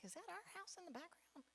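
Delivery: half whisper, squinting at a photo; hushed dawning alarm. Retold or imitated. Spotting own house in the post, hushed and high. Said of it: "like, the, like".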